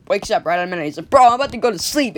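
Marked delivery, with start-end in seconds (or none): 1.1-2.2 higher and louder, indignant whine; annoyed protest. Acted. Jonathan whines at the gang for waking him, high and loud. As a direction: protest at being woken. Woken up by a whack.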